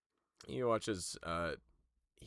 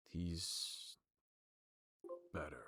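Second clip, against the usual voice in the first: softer, grave herald; quiet and solemn, a touch lower; the Herald's voice; 3 semitones lower, 6 dB quieter, slower than usual. Acted.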